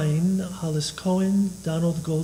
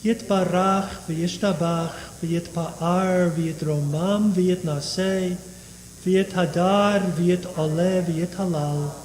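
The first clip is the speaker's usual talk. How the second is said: steady liturgical chanting of Kaddish; prayer recitation; slower than usual. Acted. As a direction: recite the Aramaic prayer evenly.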